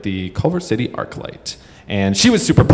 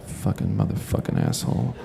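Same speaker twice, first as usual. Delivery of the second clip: much softer acted grumble; imitated muttering; 10 dB quieter than usual. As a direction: curse quietly under your breath. Talker curses under his breath.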